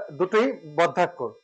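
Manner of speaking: ordinary delivery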